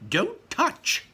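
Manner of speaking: high, loud snap of a warning; shouted warning. Acted. Wonka warning the children off, high and loud. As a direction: shout it to stop grabbing hands. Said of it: "shouted Mister Wonka".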